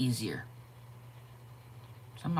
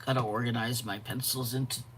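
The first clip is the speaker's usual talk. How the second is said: ordinary delivery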